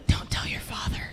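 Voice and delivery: laughing whispered secret; giggly whisper. Pretend. Stoned parent hushing the kid, laughing whisper. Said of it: "be like".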